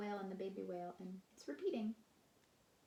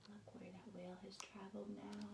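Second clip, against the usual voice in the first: whisper; 10 dB quieter than usual. Acted. Hushed story moment.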